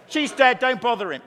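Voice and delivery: loud, blunt New Yorker brush-off; brutal New Yorker. Acted. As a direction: break bad news like a brusque New Yorker. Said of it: "They're New Yorkers, aren't they?".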